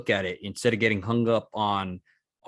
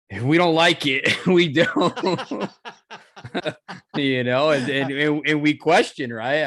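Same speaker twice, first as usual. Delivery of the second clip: laughing; 5 semitones higher, 6 dB louder, slower than usual. Real, not acted. Laughing about being humbled.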